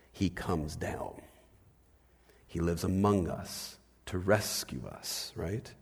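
soft, slow and low; tender. Real, not acted. Pastor telling the congregation Jesus came near, soft and slow. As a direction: bring it down gently, close and caring. Tender contrast after barking.

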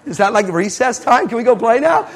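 laughing high through the words. Real, not acted. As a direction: giggle out the joke to the crowd. Laughing joke to audience.

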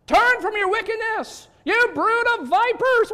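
high, loud fiery rebuke; preacher yell. Retold or imitated. John the Baptist rebuking the crowd, furious prophet yell. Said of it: "And he would yell it".

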